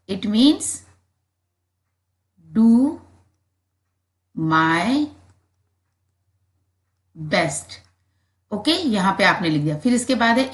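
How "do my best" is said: slow dictation. Shown as a demonstration. Teacher dictating do my best slowly for pupils to write. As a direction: do my best said word by word, fading. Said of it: "आप लिख सकते हैं it means".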